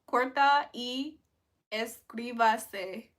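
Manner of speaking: slow, deliberate corta y inscríbase; slow careful Spanish. Shown as a demonstration. Streamer trying Spanish for chat, slow and careful. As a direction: spell out corta y inscríbase word by word. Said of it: "I said, "Like and subscribe".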